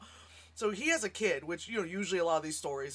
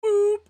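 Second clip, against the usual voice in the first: nose boop sound; 13 semitones higher, 12 dB louder than usual. Retold or imitated. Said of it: "and he goes".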